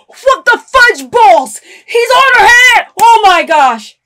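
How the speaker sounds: loud, high gasping outburst; gasp, then cry out in shock; shocked shouting. Real, not acted. Shocked reaction to show.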